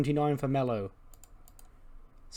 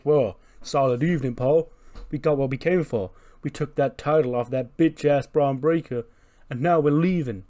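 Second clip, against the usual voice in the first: Roman boasting; 3 semitones higher, 6 dB louder than usual. Retold or imitated. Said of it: "he's like".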